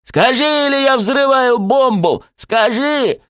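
high, frantic ultimatum; desperate shout. A narrator's reading. Coach threatens the bomb for an answer, shouting. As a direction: yell the threat at high pitch.

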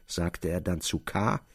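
ordinary delivery, nothing marked

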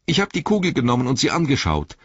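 high, stammering confession; Pippin. A narrator's reading. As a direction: stammer it out, frightened. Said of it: "stammelte Pippin". (stammelnd, mit hoher Stimme)

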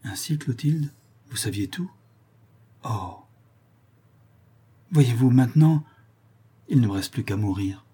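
mildly despairing, theatrical lament; a touch of tragic despair; pathetic despair. A narrator's reading. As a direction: plead like a ruined man, understated. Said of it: "du ton le plus pathétique et le plus désespéré".

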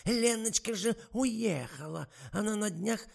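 высокий, медленный старушечий голос; ласково уговаривает, как подслеповатая старушка; болтливая старуха. A narrator's reading. (высокий тягучий голос старой актрисы)

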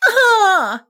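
high, loud sudden cry of pain; labour cry. Acted. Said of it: "закричала Лукаша". (вскрик роженицы)

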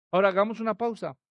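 ordinary talk

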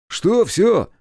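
high, loud puzzled query; baffled question. A narrator's reading. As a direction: blurt it out in bewilderment. Степ does not understand.

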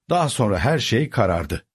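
plain reading voice

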